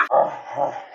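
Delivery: loud re-enacted guffaw trailing into giggles; imitated burst of laughter. Re-enacting her reaction to the twins, loud burst of laughter. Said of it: "I busted out laughing, like, straight up".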